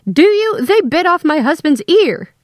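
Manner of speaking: high-pitched, loud outrage; snapped. A narrator's reading. Wife protests husband's bitten ear, loud and outraged. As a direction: cry out the accusation, scandalized. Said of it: "Darlene snapped".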